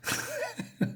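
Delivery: squealing laugh; high laugh. Real, not acted. The reader laughs at the sarcastic line, high squealing laugh. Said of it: "laugh at comic".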